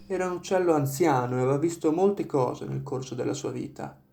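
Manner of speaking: usual speaking voice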